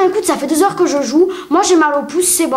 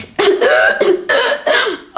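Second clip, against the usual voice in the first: mock dramatic cough; 9 semitones higher than usual. Mocking sick boy.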